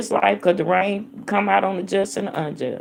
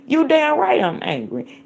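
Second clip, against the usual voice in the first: angry; 6 semitones higher than usual. Real, not acted.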